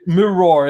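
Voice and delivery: mirror with a full "-ror" ending; all letters pronounced. Shown as a demonstration. German showing the German habit, mirror as "mir-roar". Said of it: "because we try to pronounce".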